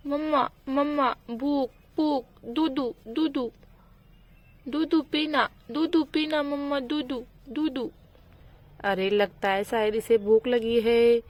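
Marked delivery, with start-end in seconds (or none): 0.1-7.9 put-on higher, babyish whine for milk; a hungry little child pleading; toddler voice. Chintu begging his mother for milk, higher babyish whine.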